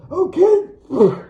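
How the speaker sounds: O'Keefe shrieked very high and loud, whistling; whistled yell. Acted. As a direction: yell O'Keefe through missing teeth. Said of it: "She accidentally whistled".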